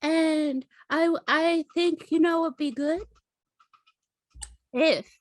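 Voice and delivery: high, gentle imitation of a suitor; tender proposal. Character retells a man's tender proposal.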